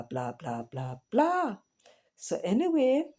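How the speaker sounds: normal talking voice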